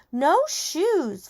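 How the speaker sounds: put-on high-pitched stubborn toddler; protesting little kid. A narrator's reading. Fudge to his mother, high childish protest. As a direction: refuse like a small child, high. Fudge refuses new shoes.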